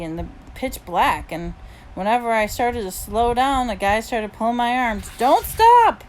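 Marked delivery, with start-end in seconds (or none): 5.2-6.0 very high, loud shout; urgent yell. A narrator's reading. Boy dragging a friend through the dark, urgent yell. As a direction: yell to keep them running. Urging a runner during a chase.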